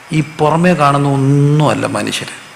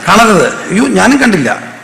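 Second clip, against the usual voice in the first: higher and louder, playful alarm; excited exclamation; 6 semitones higher, 4 dB louder, slower than usual. Real, not acted. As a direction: burst out in mock alarm. (ഉറക്കെ കളിയായ അയ്യോ)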